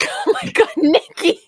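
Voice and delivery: high squeal breaking into laughter; can't hold the laugh, voice shoots up. Real, not acted. Laughing fit during panel intro.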